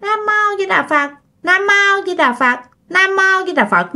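high, monotone parroted chant; high-pitched ghost mimicking a sutra; mocking ghostly mimicry. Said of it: "thì nó nhại".

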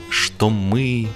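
plain reading voice

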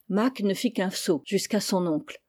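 usual speaking voice

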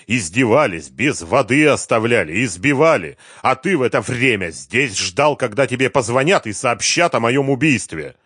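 loud, mounting reproach; angry accusation. A narrator's reading. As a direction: hammer out each charge with force. Victim angrily accuses the cowering man.

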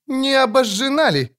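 high nervous question; nervous priest. A narrator's reading. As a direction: ask it in rising dread. Priest voiced.

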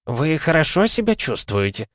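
high, breathless kindly voice; concerned old man. A narrator's reading. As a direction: ask gently, out of breath. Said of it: "Он тяжело дышал".

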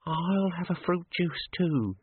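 high, slow, timid quaver. A narrator's reading. Frightened dwarf ordering after a threat, high quaver. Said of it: "she quavered".

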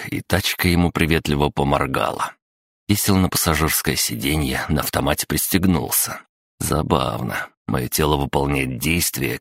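ordinary delivery, nothing marked

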